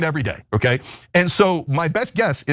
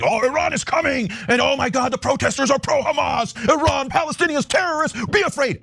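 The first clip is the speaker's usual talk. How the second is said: high, shouted mock panic; higher shrill-free mock alarm, shouted; mocking fearmonger; 8 semitones higher than usual. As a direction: ham up the scaremongering anchor.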